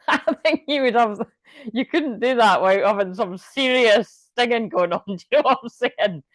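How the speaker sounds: giggling through the words; can't stop laughing while talking. Real, not acted. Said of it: "although I can't stop laughing right now".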